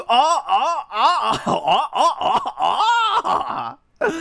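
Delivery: breathy mock moans rising high, over the top; exaggerated voiced-over sex moans, played for laughs; hammed-up moaning. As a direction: moan theatrically like a game heroine, pitch climbing. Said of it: "そんな声出すかね？".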